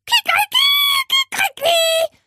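very high, loud crowing; rooster crow. A narrator's reading. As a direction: crow shrilly like a rooster at dawn. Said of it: "Hatte sich etwa ein Hahn".

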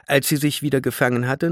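plain reading voice

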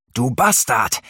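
high, loud curse from the ground; furious roar. A narrator's reading. Narrator roaring at Thor from the ground. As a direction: bellow it in rage. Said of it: "brüllte ich vom Boden aus".

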